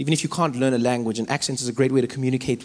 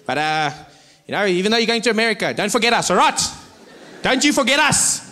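higher, lively fan calling out; excited send-off from a local; excited well-wishers; 6 semitones higher than usual. Acted. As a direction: cheer him on like a hometown fan. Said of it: "people would say to me".

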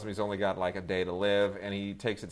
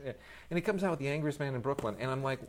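usual speaking voice

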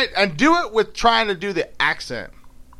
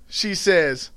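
higher, lively Southern dialect narration; storytelling in dialect; 8 semitones higher, 3 dB louder than usual. A narrator's reading. Recounting her mother's outburst, in animated Southern dialect. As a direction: tell it as a riled-up Southern storyteller.

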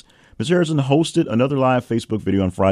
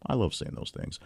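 whispered aside; 12 dB quieter than usual. Real, not acted. Aside under breath while reading.

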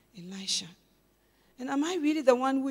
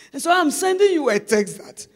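laughing; 7 semitones higher, 16 dB louder than usual. Retold or imitated.

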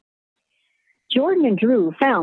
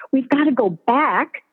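desperate plea; 6 semitones higher than usual. Acted. Pleading after wailed stop.

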